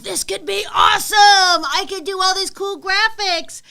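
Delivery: playful excited inner voice; excited designer brain. Retold or imitated. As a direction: pitch the idea with excitement. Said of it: "designer brain is being like".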